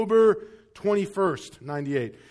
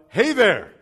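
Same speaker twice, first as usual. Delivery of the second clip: nervous greeting; 2 semitones higher, 8 dB louder than usual. Retold or imitated. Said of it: "So I'm like, uh".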